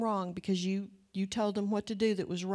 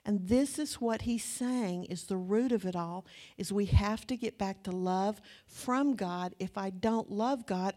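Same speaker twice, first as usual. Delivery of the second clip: normal talking voice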